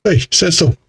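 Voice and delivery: ordinary talk, nothing marked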